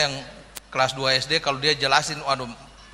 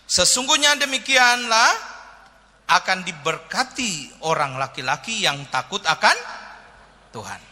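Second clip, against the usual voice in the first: slow, solemn reading of the verse; measured Bible reading; scripture reading; 4 semitones higher than usual. Acted. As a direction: read it out like holy scripture. Reads the Bible verse aloud.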